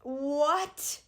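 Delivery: sudden high, loud shocked "What?". Retold or imitated. Told she is only three centimeters, a loud shocked what. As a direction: burst out in disbelief.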